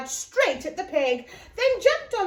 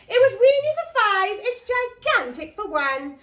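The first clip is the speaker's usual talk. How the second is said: louder happy exclaim; gleeful; 5 semitones higher, 6 dB louder than usual. Acted. Old lady telling the wise man, gleeful. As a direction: relish the change.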